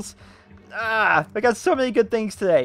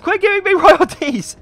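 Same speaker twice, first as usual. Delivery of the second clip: laughing protest; 11 semitones higher, 8 dB louder than usual. Real, not acted. Laughs at yet more royalties.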